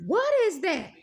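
exaggerated, punched-up astonishment; impressed reaction. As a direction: act out someone stunned by a great smell. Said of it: "they gonna be like".

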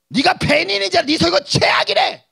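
loud high jeering shout; taunting aunt. Retold or imitated. Aunt jeering at nephew's sermons, shouting. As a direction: yell the insult at him.

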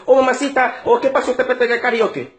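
put-on Spanish-accented mother calling her child. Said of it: "When the mom talks to them".

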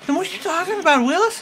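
high squeaky helium voice. Real, not acted. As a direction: chipmunk voice doing a catchphrase. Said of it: "your voice got high on helium".

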